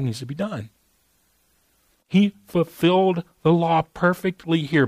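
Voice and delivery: fervent sermon delivery; impassioned preaching. Real, not acted. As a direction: drive the point home from the pulpit. Preaching at full force.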